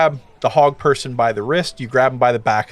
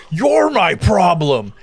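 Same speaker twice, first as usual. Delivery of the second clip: shouted retort; 5 semitones higher, 4 dB louder, slower than usual. Acted. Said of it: "He shouts".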